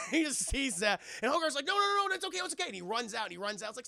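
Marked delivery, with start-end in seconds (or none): 1.7-2.6 high frantic pleading; pleading kid. Retold or imitated. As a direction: rush to calm them.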